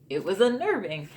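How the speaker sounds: usual speaking voice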